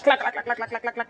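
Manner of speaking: rapid string of clacks; imitated truck clatter. Describing her old tow truck, rapid clattering imitation. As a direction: rattle like an old engine.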